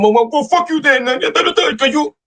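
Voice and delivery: high, sneering brush-off; mock a sore loser lashing out; imitating a bitter guy who got turned down; bitter rejected guy. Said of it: "too many guys are stuck on".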